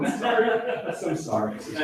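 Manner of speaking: soft, sheepish laughing apology. Real, not acted. Streamer saying sorry to the neighbour, laughing softly.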